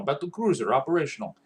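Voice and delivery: put-on Russian-accented battlecruiser captain; crisp military report in a Russian accent. Player quoting a StarCraft captain, Russian accent. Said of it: "he sounded a lot like the battlecruiser captain from StarCraft".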